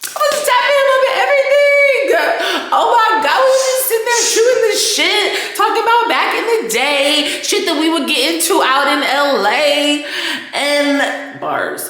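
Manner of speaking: high, loud, laughing gush; bubbling over with giddy excitement; excited laughing. Real, not acted. Tipsy vlogger gushing about hanging with a celebrity, laughing loudly.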